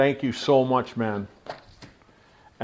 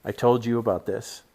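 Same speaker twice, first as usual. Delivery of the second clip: usual speaking voice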